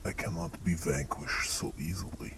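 menacing whisper. Acted. Strahd's menacing whispered reply.

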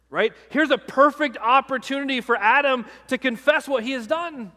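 ordinary delivery

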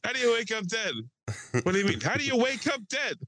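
high, lightly mock-incredulous film quote; Buscemi movie line. Friends riffing on a movie line, mock-incredulous. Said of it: "it's a Steve Buscemi, uh, line".

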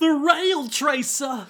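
high, drawn-out wail of alarm; bad Jacuzzi impression. Jacuzzi finds two bodies on the train, wailing in panic. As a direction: cry it out in a silly, strained high voice. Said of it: "who does my bad impression of Jacuzzi sound like".

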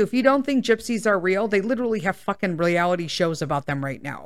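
ordinary talk, nothing marked

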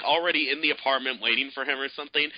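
normal talking voice

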